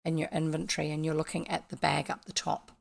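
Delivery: normal talking voice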